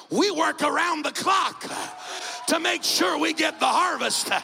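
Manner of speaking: loud, high, driving sermon cadence; full-force preaching. Acted. Preacher firing up the Rock Church, loud preaching. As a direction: preach it to the rafters.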